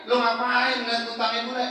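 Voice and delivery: high, loud preacher's punchline; fiery sermon climax; preaching at full force. Acted. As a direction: drive it home to the congregation.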